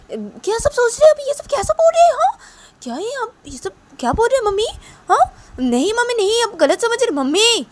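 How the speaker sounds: high, loud protesting daughter; विरोध करती बेटी. Acted. (ऊँची आवाज़ में माँ का विरोध करती बेटी)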